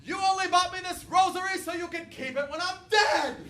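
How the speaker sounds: high, loud guilt-trip shout; dramatic grandma. Retold or imitated. Grandma ending the pasta argument with a loud melodramatic shout. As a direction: end the fight with a melodramatic jab. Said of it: "she would say something like".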